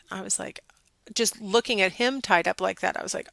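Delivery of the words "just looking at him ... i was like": ordinary talk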